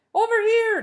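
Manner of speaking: high, loud call across the rooftop. A narrator's reading. Jive calls Harvey over across the roof, a high loud call. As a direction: shout him over from a distance.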